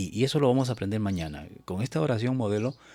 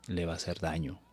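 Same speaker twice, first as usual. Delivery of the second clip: usual speaking voice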